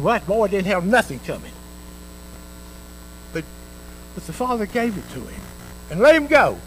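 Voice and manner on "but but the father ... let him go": ordinary talk, nothing marked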